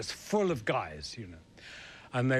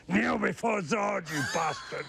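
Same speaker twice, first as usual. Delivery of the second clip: General Zod voice; 9 semitones higher than usual. Retold or imitated. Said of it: "and I say".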